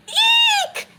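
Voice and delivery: high squeaky eek; high scared squeal. Shown as a demonstration. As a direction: squeal at the spider. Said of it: "I go".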